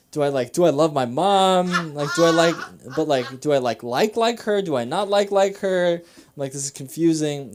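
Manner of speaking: hammed-up freak-out over a confusing feeling. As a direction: act out anxious self-questioning, pitch climbing. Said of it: "I'm freaking out and, like, super, like, anxious".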